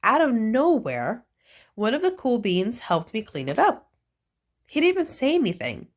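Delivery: usual speaking voice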